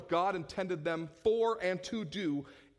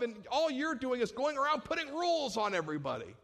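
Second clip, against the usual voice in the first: angry rebuke; 8 semitones higher than usual. Acted. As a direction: blast them like a fed-up prophet. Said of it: "the people he'd yelled at".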